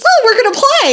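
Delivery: high, giddy puppy voice; excited dog. Retold or imitated. Storyteller voicing her dog who thinks it's playtime, high and excited. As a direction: chirp it like a dog ready to play. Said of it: "And now Daisy thinks".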